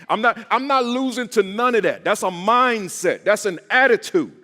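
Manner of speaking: loud, high, emphatic preaching cadence; rising, forceful rally speech. Real, not acted. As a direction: hammer each line like a Baptist preacher. Motivational speaker hammering his mindset.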